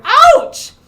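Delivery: high, very loud yelp; hollered ouch. Acted. Shark pricked by a pufferfish, hollering in pain. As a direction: cry out in sudden storybook pain. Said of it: "he hollered".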